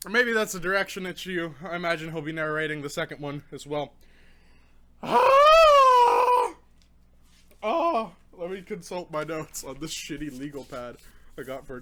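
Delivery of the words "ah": long, high, loud cry of pain; drawn-out real wail from the burn. Real, not acted. Reviewer burning from a habanero, long pained wail. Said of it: "I'm in so much pain".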